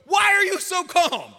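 loud, high, slow disbelieving cry; incredulous shout. Pretend. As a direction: shout it in amazement at their calm. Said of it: "they should look at you and go".

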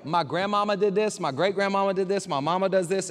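ordinary talk, nothing marked

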